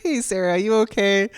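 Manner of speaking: retold careful hello to a rattled caller; concerned greeting.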